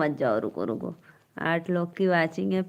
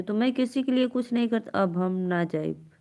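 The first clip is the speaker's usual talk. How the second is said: ordinary talk, nothing marked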